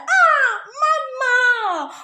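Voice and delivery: loud, high, overdone cry of mama; mock dramatic shout. Advising brides, she mocks a loud dramatic entrance. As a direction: ham up a loud dramatic greeting.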